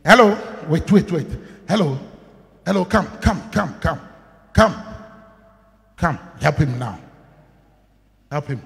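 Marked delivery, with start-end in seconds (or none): none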